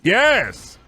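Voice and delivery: high, loud cheery boss shout; a boss hyping a pizza party; mock pizza party cheer. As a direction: shout it like a fake-cheerful manager.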